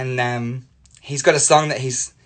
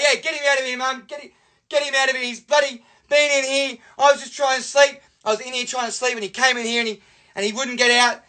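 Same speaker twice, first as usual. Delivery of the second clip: put-on high, aggrieved little-boy complaint; whining tattletale; 10 semitones higher than usual. Boy blaming his brother to Mum, high whiny protest. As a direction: plead innocence to your mother in a high voice.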